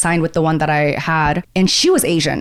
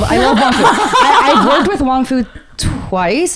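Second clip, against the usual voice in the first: laughing; 6 semitones higher, slower than usual. Real, not acted.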